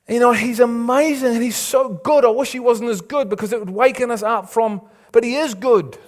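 high, forceful sermon delivery; impassioned preaching. Real, not acted. Preacher challenging the congregation on being Peters, impassioned. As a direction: preach it out, loud and driving.

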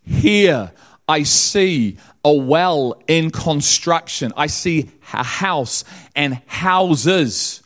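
high, loud, impassioned sermon delivery; preaching at full force. Acted. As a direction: drive it home like a revival preacher, slow on key words.

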